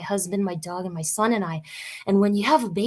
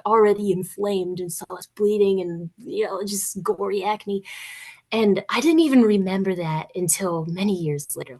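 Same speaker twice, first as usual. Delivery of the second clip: usual speaking voice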